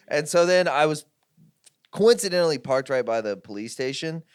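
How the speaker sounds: ordinary talk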